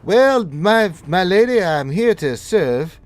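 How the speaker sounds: high, loud, showy character voice; big high-pitched squawk of devoted service; Bruce the servant. Acted. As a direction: proclaim it to your lady, then ease off. Game master plays Bruce.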